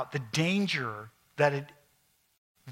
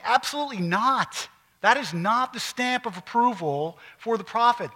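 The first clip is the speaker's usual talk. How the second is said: raised, louder emphatic rebuttal; emphatic denial; 7 semitones higher, 5 dB louder than usual. Retold or imitated. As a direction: reject it forcefully, pitched high. Preacher voices Paul's firm rejection.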